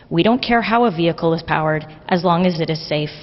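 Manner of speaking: ordinary talk, nothing marked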